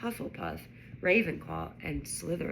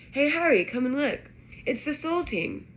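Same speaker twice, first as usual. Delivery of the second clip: higher, louder eager call; excited call; 7 semitones higher, 4 dB louder than usual. A narrator's reading. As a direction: wave a friend over to see. Ron calls Harry over excitedly.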